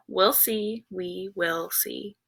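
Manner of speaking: soft, slowing to a hushed close; patient grandpa. A narrator's reading. Grandpa holding the bomb, soft slow answer. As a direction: trail into quiet, drawn-out suspense. Grandpa's quiet closing words.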